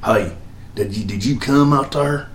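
a soft imitation of an old actor drawling his film line; R G Armstrong impression. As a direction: softly mimic the old actor, quietly. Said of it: "I hate the way that guy talks".

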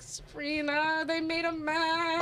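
slow, drawn-out complaint to a friend; mock crying. Telling Sabrina about the mess, mock crying. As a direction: stretch it out in a retold tearful wail. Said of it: "Bro, I was crying already".

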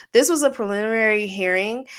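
ordinary talk, nothing marked

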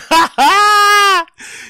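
sudden shrieking laugh, way up high; mocking high-pitched laugh; burst of high laughter. Real, not acted. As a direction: cackle at the goodbye. Mocking [name]'s farewell.

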